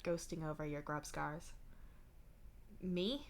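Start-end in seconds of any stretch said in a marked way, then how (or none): 2.8-3.3 small questioning rise; uncertain. A narrator's reading. Asking Dave what he means, uncertain. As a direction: ask it softly, unsure. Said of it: "you ask uncertainly".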